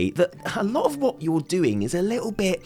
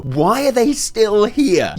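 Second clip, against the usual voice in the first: exasperated; 4 semitones higher, 5 dB louder, much slower than usual. Acted. Exasperated rant, question repeated later.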